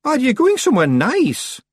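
high, loud chirpy question; tactlessly cheerful small-creature voice; Rob Anybody, cheeky Feegle. A narrator's reading. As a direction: ask brightly as a little Scots creature.

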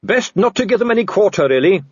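high, breezy practical advice; cheerily brisk rescuer, voice lifted; cheerful. A narrator's reading. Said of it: "said a voice cheerfully".

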